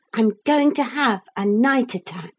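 put-on high, eager boy character; excited. A narrator's reading. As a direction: announce a secret plan with contained excitement. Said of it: "in a low, excited voice".

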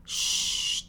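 unvoiced shush; sustained "shh"; hushing shh. A narrator's reading. Louise hushes Jeannie.

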